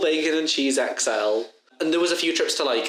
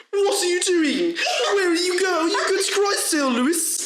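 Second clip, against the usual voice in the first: mocking squeal. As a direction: parrot the teacher in a squeaky shriek. Mocking the angry tutor back.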